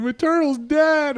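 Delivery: very high, slow crying wail; mock bawling. Friend mimics a bawling boy over his turtle, high mock wail. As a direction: whimper it out like a sobbing kid. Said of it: "He's like".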